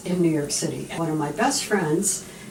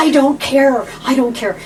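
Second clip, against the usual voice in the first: loud, high insistence; retelling an eager loud insistence; insistent; 7 semitones higher, 12 dB louder than usual. Retold or imitated. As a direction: push past her objection loudly. Insisting on trade.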